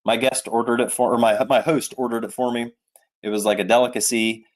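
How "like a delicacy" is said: usual speaking voice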